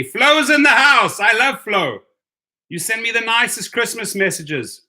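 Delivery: loud, high whoop of welcome; excited shout-out. Real, not acted. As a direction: hail a friend joining with delight. Hails a friend joining the stream.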